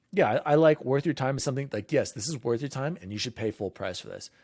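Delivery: ordinary delivery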